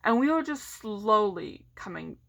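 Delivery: ordinary delivery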